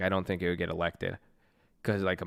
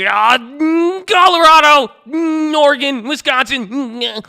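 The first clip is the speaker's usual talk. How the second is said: very high, loud mock squeal of the states; mocking chat; 14 dB louder than usual. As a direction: yell each state in a silly high voice like an overeager viewer. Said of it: "so it's like".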